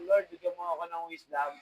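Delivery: a quick, earnest little plea to the Lord; a touch of a desperate student praying; pleading prayer. Pretend. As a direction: pray it fast like a desperate student.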